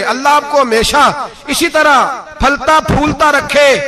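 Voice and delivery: growing gratitude. Retold or imitated. Widow's gratitude rising with each daughter.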